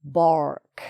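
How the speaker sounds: "bark" in an American accent with a strong R before the K; punchy American-style "bark" with hard R. Shown as a demonstration. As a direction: demonstrate American "bark", R fully sounded. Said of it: "an American person might say".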